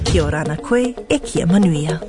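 ordinary talk, nothing marked

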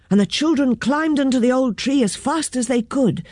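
ordinary delivery, nothing marked

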